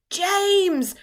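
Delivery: high, loud bawl; bawled name. Acted. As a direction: bawl for help. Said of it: "bawled the centipede".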